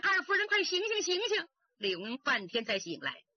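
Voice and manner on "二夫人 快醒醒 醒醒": calling out. A narrator's reading. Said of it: "扯着耳朵喊".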